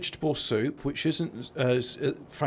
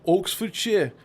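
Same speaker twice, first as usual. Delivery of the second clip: lightly imitated Brummie accent; 3 semitones higher, slower than usual. Brummie answering where he lives now, Brummie accent. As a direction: give it a touch of Brummie. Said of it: "I was talking to a Brummie".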